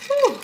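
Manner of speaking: sharp high whew of relief. Real, not acted. Alone on stream, a high whew. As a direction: blow it out high and short. Reacting again, exclaiming.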